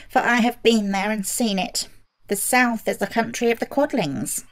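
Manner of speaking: a small man telling what he saw; Munchkin. A narrator's reading.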